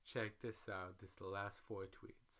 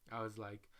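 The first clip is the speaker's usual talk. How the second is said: ordinary talk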